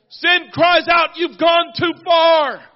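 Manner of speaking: high, loud, slow cry of sin; accusing shout. Acted. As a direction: bellow it like an accuser. Sin's accusation shouted in sermon.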